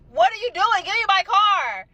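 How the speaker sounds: indignant yelling at the car thief; retold demand. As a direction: call out to the thief to give the car back.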